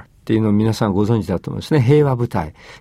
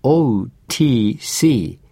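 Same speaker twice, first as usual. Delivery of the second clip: spelled out; 2 semitones higher, slower than usual. Shown as a demonstration. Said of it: "アブリビエーションでですね".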